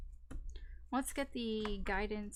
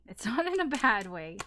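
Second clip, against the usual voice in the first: louder, with a laugh in the middle; 2 semitones higher, 7 dB louder than usual. Real, not acted. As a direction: laugh through the reassurance. Repeats reassurance, then laughs.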